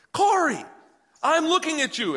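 high, insistent call for attention. Retold or imitated. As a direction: call sharply to a child who won't look. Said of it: "And I, and I'm yelling".

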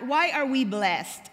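ordinary talk, nothing marked

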